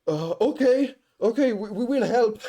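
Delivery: high, faltering imitation of bystanders; hesitant helpers. Onlookers asked to help a grape-covered worker, mock hesitancy. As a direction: agree uneasily in a high put-on voice. Disgusted helpers reluctantly agree.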